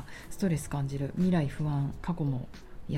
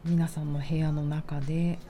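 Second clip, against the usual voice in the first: guided meditation. Acted. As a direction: guide them gently into calm. Leads a grounding meditation.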